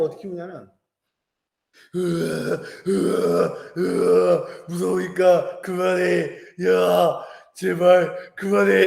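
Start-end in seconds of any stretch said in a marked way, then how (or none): 1.9-8.9 mock wailing, slow and pleading; imitating a scared cry. Toddler scared of her dad crying, mock wail. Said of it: "이렇게 울어요".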